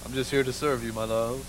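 low, soft, devoted murmur to his wife; romantic husband. Retold or imitated. Husband voiced in story.

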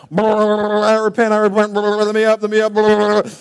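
burbling words through water, long and drawn out; eunuch gargling underwater. Acted. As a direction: sputter and bubble as if held underwater while being baptized. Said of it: "I'm the eunuch now".

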